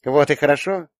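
put-on high girlish sweetness; sweetly smug. A narrator's reading. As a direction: say it through a sweet smile. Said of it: "сладко улыбнулась Юля". (сладко, высоким голосом дочки)

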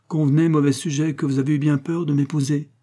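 ordinary delivery

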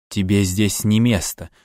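plain reading voice

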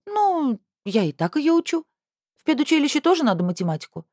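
put-on high, fast snorting teen girl; mean girl. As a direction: snap back like a sulky teenager. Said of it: "фыркнула Анжелика".

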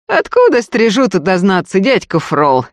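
scoffing question, starting high and falling; dismissive snort. A narrator's reading. Robber shrugging off his elder's warning, scoffing. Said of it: "хмыкнул Фёдор".

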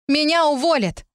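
high, desperate appeal; appealing for sympathy. A narrator's reading. Narrator to the hostile crowd, pleading. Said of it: "взывала я к сочувствию".

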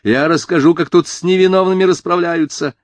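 high, loud monotone cry; indignant shout held on one high pitch; shouting on one note. A narrator's reading. Angry mother threatening to expose the police, shouting on one note. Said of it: "Крикнула она на одной ноте".

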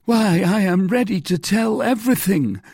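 high, loud plea from a frightened prisoner; anxious cry. A narrator's reading. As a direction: cry out your eagerness to cooperate. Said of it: "cried Bonacieux".

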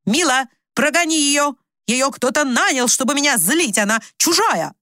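high, urgent complaint; agitated demand. A narrator's reading. Mother demands stranger be sent away.

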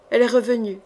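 usual speaking voice

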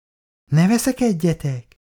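put-on high-pitched child's plea; pleading child. A narrator's reading. Son begs quarrelling parents, high pleading child. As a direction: beg them to stop, high like a child.

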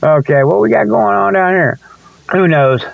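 normal talking voice

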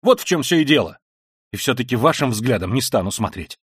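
high, loud, impassioned ranting; frenzied tirade. Acted. Raskolnikov ranting at his sister, frenzied and loud. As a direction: hurl it all out in frenzy.

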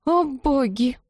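high, mildly strained exclamation; wheezed. A narrator's reading. Bride hearing where the arrow landed, wheezing. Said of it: "просипела Тина".

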